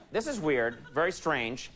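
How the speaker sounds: normal talking voice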